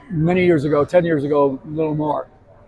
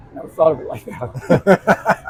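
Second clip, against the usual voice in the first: slow realization. Retold or imitated. Magician's light bulb moment, acted.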